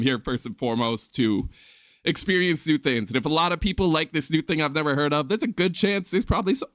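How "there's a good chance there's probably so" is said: hiccups. Real, not acted. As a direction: voice jumps up with hiccups. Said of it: "hopefully you can bear the hiccups".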